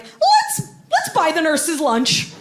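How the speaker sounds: high, slow sugary campaigner voice; sing-song like a Facebook do-gooder; mock do-gooder. Mocking Facebook campaigners, high sugary sing-song.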